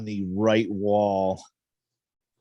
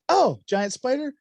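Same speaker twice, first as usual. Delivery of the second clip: mock alarm; 3 dB louder than usual. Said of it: "he's like".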